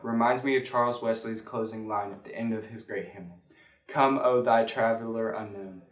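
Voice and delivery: usual speaking voice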